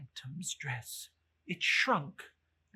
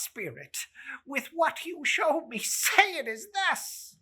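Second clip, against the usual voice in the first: much higher and louder, stammering and begging; desperate Scrooge; 8 semitones higher, 10 dB louder than usual. Acted. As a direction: plead hard for another ending. Scrooge pleads for a different fate.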